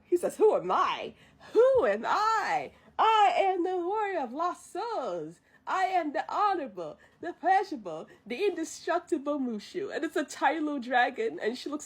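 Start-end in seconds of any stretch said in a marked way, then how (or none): 0.4-10.0 Mushu's grand introduction. Retold or imitated. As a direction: announce yourself with mock grandeur, stretching the words.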